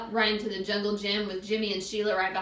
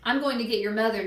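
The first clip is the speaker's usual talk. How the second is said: ordinary delivery, nothing marked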